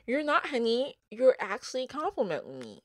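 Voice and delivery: high, sing-song sassy comeback; sassy retort. Pretend. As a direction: put them straight, playfully smug. Sassy retort to imagined detractors.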